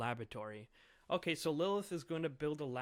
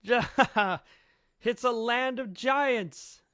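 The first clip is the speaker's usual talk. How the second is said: very slow, high, loud and gleeful; delighted laughing cry; 9 semitones higher, 12 dB louder, much slower than usual. Acted. Luffy laughing while giant kids chase him, slow high gleeful cry. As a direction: stretch it out in wonder and glee. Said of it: "And Luffy's just laughing".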